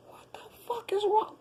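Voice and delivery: breathless unvoiced whisper; a sudden whispered aside; incredulous whisper. Real, not acted. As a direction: hiss it under the breath in disbelief. Whispered aside mid-rant.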